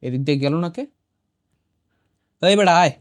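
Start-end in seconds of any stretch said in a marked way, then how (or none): 2.4-2.9 louder, higher call to come. Real, not acted. Player calling a wayward teammate to follow, calling out. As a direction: summon a straggler sharply.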